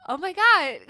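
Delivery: high, loud laugh through the words; laughing in disbelief. Real, not acted. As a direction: crack up on it. Laughing hard.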